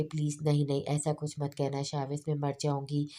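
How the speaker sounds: ordinary delivery, nothing marked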